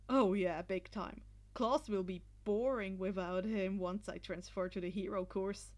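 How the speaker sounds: confident. A narrator's reading. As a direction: say it like it's obvious. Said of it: "Shinzo answered confidently".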